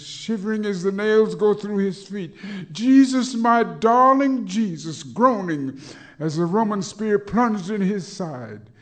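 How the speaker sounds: high, loud lament; anguished wail. Acted. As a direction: cry out his suffering. Climax of crucifixion.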